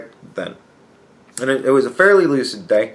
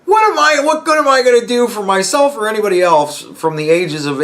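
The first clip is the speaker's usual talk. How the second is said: higher, louder exasperated question; incredulous rant; 9 semitones higher, 3 dB louder than usual. Retold or imitated. Vlogger imagining his own old age, loud incredulous question. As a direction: demand it in disbelief. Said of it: "'cause I'm like".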